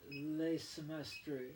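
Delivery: Le Semestre said slowly; slow French Le Semestre. Shown as a demonstration. Showing viewers the French word, Le Semestre slowly. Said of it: "I'll show you guys".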